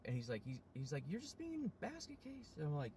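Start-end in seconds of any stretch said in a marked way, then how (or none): 1.1-2.5 high falsetto mock scolding; high-pitched retold reproach; imitated fed-up partner. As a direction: mimic an exasperated partner in a squeaky voice.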